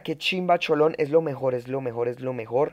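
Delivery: ordinary talk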